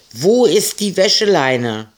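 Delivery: high, loud searching call. Acted. Ida calling for Marie about the missing washing line. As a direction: shout the question across the yard. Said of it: "rief Ida noch einmal".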